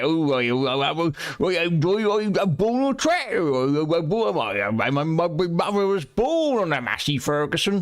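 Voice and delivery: high, loopy Fenland yokel drawl; Fenland farmer accent. Acted. Streamer mocks how locals talk around here, exaggerated Fenland accent. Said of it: "That's how we talk around here".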